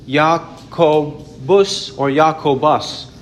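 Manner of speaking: Jacobus sounded out slowly with an initial Y; Greek and Latin pronunciation. Shown as a demonstration. Preacher shows the Greek form of the name, Jacobus slowly with a Y sound. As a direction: say Jacobus as "yah-ko-bus", syllable by syllable. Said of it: "When it is in Greek".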